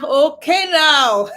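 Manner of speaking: laughing through okay now; amused, can't hold the laugh. Real, not acted.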